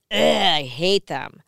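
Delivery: loud, drawn-out groan of disgust; mock-revolted outburst; retold disgust. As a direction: blurt out exaggerated loathing, slowly. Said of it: "And I went".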